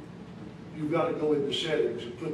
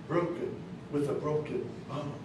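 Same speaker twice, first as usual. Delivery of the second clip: normal talking voice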